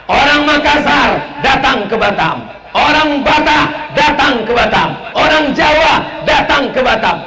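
high, loud rising proclamation; full-force preaching. Acted. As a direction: call it out to the whole crowd.

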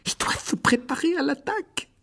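high-pitched panicky voice; frightened. A narrator's reading. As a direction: squeak out the dreaded warning. Terrified Ron expects an attack. (voix aiguë, paniquée)

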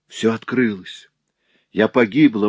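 understated despairing fright; frightened exclamation. A narrator's reading. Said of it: "воскликнула перепуганная Луиза". (испуганно, с отчаянием)

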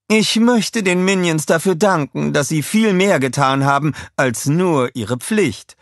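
high, loud, effusive thanks; public speech. A narrator's reading. Scarlett thanking Minions before reporters, grand public speech. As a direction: address the press grandly. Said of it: "sagte sie zu den Reportern".

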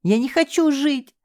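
high keening wail; wailing lament. Acted. Инна причитает, что не хочет жить, высоким плачем. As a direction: lament it through tears. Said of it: "запричитала Инна".

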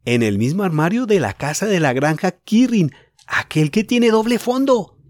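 put-on boy bursting with news; thrilled. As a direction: draw out the excitement. Julián reveals secret.

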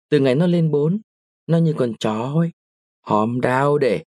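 plain reading voice

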